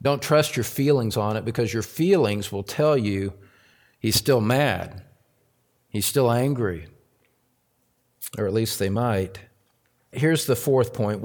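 ordinary talk

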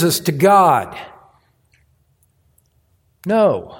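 emphatic pulpit rebuttal, climbing high at the close; forceful preaching. Real, not acted. Preacher rejecting comparison to man before God, forceful preaching. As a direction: build to a high, emphatic finish.

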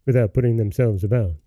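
usual speaking voice

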